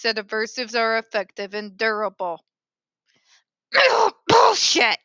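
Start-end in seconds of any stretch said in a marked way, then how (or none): 3.7-4.2 loud staged cough; fake cough. Fake cough before outburst. 4.3-5.0 loud high outburst; shouted curse. Acted. Outburst hidden in cough.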